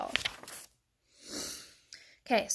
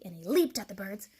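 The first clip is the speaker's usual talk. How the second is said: ordinary delivery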